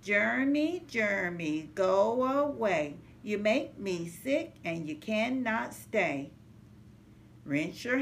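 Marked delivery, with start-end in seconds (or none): none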